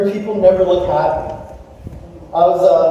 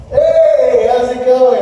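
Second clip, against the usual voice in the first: louder, higher, put-on friendliness; over-cheery greeting; 4 semitones higher, 5 dB louder, slower than usual. Pastor caught fuming in line by a parishioner, mock cheery greeting. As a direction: cover annoyance with a big hello.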